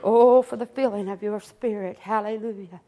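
soft, low murmured praise; quiet prayer. Real, not acted. Preacher murmuring prayer to the Lord, soft and low. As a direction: pray quietly before the sermon.

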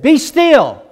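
Jesus ordering the waves, shouting; commanding shout. Acted.

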